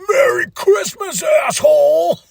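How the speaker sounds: high, loud mock shout. [name] ending the fight taunting, hammed-up shout. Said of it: "[name] ends it with".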